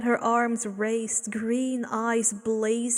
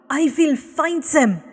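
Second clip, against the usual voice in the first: raised, higher angry snap; snapped; 5 semitones higher, 9 dB louder than usual. Acted. Abel snaps his vow at the pair, raised. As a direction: bite out the vow. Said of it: "Abel snapped".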